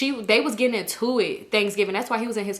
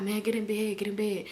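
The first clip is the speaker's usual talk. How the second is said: mimicking sleepy mumbling; 8 dB quieter than usual. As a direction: imitate him half-awake, much softer. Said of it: "So he wake up".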